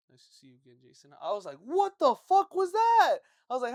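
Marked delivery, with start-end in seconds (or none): 0.1-1.1 very soft, low dad imitation; not screaming. Yelling dad turns to greet son's friend, suddenly quiet. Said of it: "only time I never heard him scream". 1.6-3.2 high, loud, slow mock disbelief; incredulous shout. Boy reacting to dad's greeting, incredulous mock shout. Retells shocked reaction to the dad.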